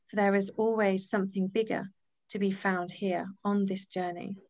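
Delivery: reading a message from Rosa aloud to the group; inspirational reading. Acted. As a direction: read it like a calm blessing. Reads a page from Rosa's Choice.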